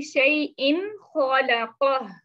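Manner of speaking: very slow recited शैइन खलक़ह; Quran recitation. Shown as a demonstration. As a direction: intone शैइन खलक़ह syllable by syllable. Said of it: "next example में देखें जी".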